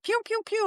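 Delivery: very high playful "pew" noises; high pew sounds. Acted. Guest imitating the body releasing love drugs, high pew sounds. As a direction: fire off high-pitched little pews. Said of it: "Your body's like".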